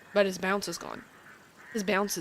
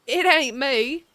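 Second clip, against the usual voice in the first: loud, hammed-up Southern accent; big Southern character voice, punched out; super southern accent; 2 semitones higher, 6 dB louder than usual. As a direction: lay the Southern accent on thick. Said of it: "with a super southern accent".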